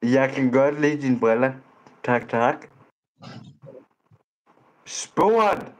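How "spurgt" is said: mocking retort.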